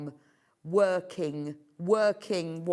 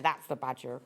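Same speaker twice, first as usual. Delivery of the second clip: lightly imitating a West Country accent; a hint of a West Country accent, low; 6 semitones lower than usual. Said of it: "he did it in a proper West Country accent".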